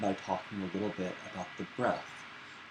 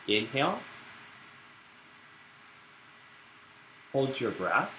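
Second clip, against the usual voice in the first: guided breathing; 2 semitones higher, 6 dB quieter than usual. Acted. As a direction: lead the class through each breath.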